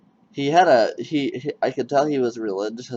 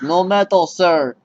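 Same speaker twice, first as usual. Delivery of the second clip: higher, louder mock clerk reply; mock shouting clerk; 7 semitones higher, 8 dB louder, slower than usual. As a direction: bark the clerk's "no metal, sir".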